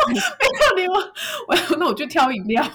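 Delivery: high laughing words, almost teary; can barely get the words out for laughing; laughing hard. Real, not acted. Still fixated on clams, she laughs it out to her friend. Cracking up at her own story.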